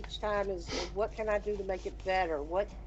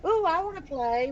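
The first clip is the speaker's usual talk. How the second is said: eager; 8 semitones higher, 4 dB louder than usual. Retold or imitated. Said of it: "he'll say".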